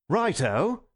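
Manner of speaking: high, chirpy cockney; clear cockney voice. A narrator's reading. Unmasked Gogol startles the table, clear cockney voice. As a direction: pipe up in commercial cockney. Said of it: "somewhat cockney voice".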